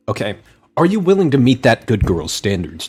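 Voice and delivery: ordinary talk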